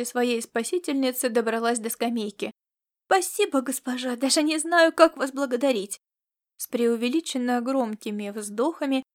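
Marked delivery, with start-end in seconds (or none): 3.1-6.0 put-on small girl's raised voice; grateful young girl. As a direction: thank her as an eager little girl. Grateful girl thanks her rescuer.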